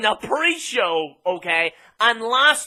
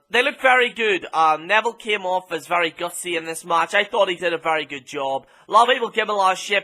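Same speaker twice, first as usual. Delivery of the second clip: ordinary talk, nothing marked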